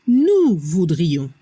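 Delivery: "nous voudrions" slow and low, nasal ending; pronunciation model. Shown as a demonstration. Teacher showing learners the nosotros conditional, slow low "nous voudrions" with nasal ending. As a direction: draw out "nous voudrions" with a silent S. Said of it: "La pronunciación".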